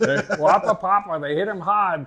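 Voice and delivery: loud, high-pitched Boston accent; hammed-up Massachusetts accent, r's dropped. Shown as a demonstration. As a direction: belt it out in broad Bay State speech. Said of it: "We like your accent".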